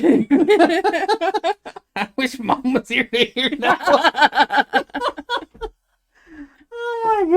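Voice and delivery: high, slow, breathless laughing words; wheezing through helpless laughter; laughing hard. Real, not acted. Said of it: "my sides hurt so bad".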